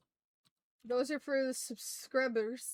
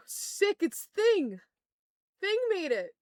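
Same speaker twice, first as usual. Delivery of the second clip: excited; 8 semitones higher, 8 dB louder, slower than usual. Real, not acted. Thrilled a character survived.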